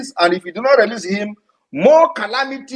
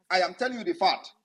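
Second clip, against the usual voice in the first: usual speaking voice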